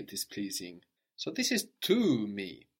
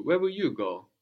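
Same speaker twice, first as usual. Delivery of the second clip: raised pitch, emphatic "you"; stress on YOU; 4 semitones higher, 4 dB louder than usual. Shown as a demonstration. Teacher repeating the prompt to student, stress on "you". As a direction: lean on "you", then fall away. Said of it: "emphasizing the word for you".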